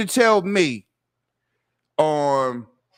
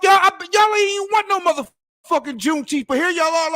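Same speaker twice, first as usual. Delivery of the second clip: raised, higher, louder outburst; angry shout; 6 semitones higher, 4 dB louder than usual. Real, not acted. Ranting at businesses selling Juneteenth, angry shout. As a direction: let the outrage burst out.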